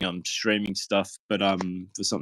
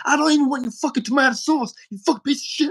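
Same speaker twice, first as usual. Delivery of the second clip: imitating angry inmate. Angry inmate yelling at the food server, high enraged. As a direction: spit it out at the server. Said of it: "this guy got really angry at me".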